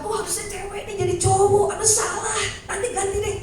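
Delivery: hammed-up worried maker; mock God fretting. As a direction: play God as a flustered clerk.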